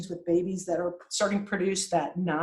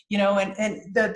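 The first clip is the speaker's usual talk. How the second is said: normal talking voice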